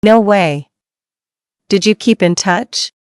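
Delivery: ordinary delivery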